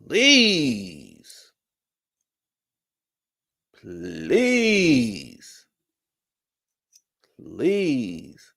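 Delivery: high, loud, drawn-out "please"; exasperated. Real, not acted. Fed up with Kyle's whining, drawn-out pleading. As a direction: let it sag with disbelief.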